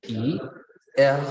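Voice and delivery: letter by letter, with pauses; spelling aloud. Shown as a demonstration. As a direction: spell it out, each letter on its own. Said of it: "lo deletrearíamos así". (deletreando letra por letra, con pausas)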